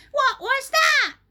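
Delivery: very high, loud hag cry; startled cry. A narrator's reading. Missus Twit feeling glue on her head, loud high startled cry. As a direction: yelp it in a shrill old woman's voice. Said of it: "cried Missus Twit".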